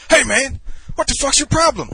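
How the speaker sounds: high, loud confrontational shout; pissed off. Retold or imitated. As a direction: imitate an angry stranger picking a fight. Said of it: "He starts getting all pissed off".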